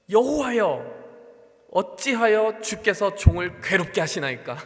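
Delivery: loud, slow lament to God; Moses' complaint. Acted. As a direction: cry out slowly and loudly, like Moses pleading. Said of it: "모세가 이렇게 이야기합니다".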